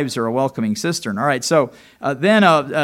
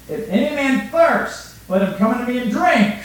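proclaimed verse; 9 semitones higher, 6 dB louder than usual. Acted.

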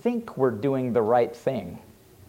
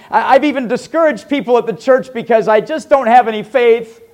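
higher, louder, desperate cry to the Lord; pleading confession prayer; 5 semitones higher, 4 dB louder than usual. Acted. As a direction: pour it out like a broken man begging God for help. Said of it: "Instead of saying".

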